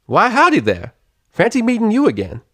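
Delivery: high, loud cheery Southern greeting; pleasant country drawl. A narrator's reading. Applejack greeting Octavia, cheery Southern drawl. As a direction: call out a friendly country howdy. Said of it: "a pleasantly drawling voice".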